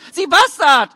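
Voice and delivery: high, loud, outraged cry; mother lashing out at the official; furious insult. A narrator's reading. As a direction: spit the insult out in fury.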